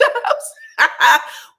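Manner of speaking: a high, loud burst of laughter; big laugh. Real, not acted. Joking to viewers about kids on countertops, big laugh. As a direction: crack up at your own joke.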